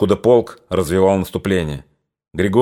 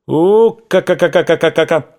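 high, louder ka-ka-ka rattle; machine-gun imitation; 8 semitones higher, 4 dB louder than usual. Shown as a demonstration. Narrator imitates machine-gun again.

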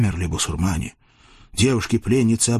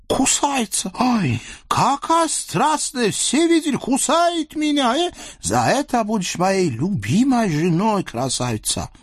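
delighted sultan; 12 semitones higher than usual. Acted. Sultan delights that Nastasya bit him, high and gleeful. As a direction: gush with glee like a spoiled sultan.

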